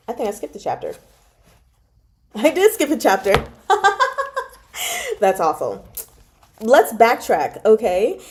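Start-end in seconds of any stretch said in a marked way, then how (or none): 3.7-4.4 a high embarrassed giggle. Real, not acted. Reader laughing after realising she skipped a chapter. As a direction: laugh at your own mistake.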